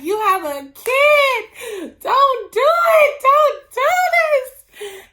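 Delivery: very high, loud, drawn-out tearful plea; upset wailing shout. Retold or imitated. Reviewer begging a book character not to leave, wailing upset. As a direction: scream it pleadingly, hammed-up and weepy. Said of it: "in tears and so upset".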